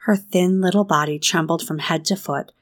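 ordinary delivery

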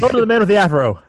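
high, loud auction call; auctioneer. Retold or imitated. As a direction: bang the gavel and call it.

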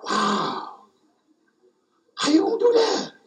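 high amazed squeak of wonder; amazed squeal. Retold or imitated. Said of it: "that was my response".

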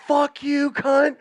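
high, loud mock tirade; high-pitched retold cussing-out; going off. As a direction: hurl insults like a defiant kid. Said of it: "I kinda went off".

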